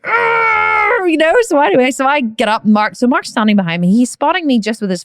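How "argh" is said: high strained shout of effort; high effortful yell under heavy weight; straining grunt. Retold or imitated. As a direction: roar through the squat.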